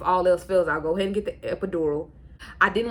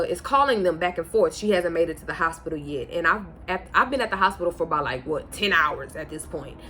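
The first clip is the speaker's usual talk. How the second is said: normal talking voice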